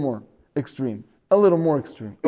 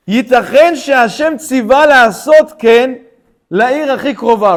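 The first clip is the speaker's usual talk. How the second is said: loud, higher, chanted reading of Hebrew; recited text; 5 semitones higher, 6 dB louder, faster than usual. Shown as a demonstration. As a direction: intone the commentary like a lesson text. Reading the Ibn Ezra text.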